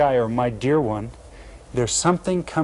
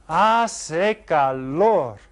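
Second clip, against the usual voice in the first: very slow, stretched hace calor; teacher drawing out "hace calor"; slow model phrase; 2 semitones higher, much slower than usual. Shown as a demonstration. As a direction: spell out "hace calor" slowly, syllable by syllable. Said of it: "slow model of weather phrase".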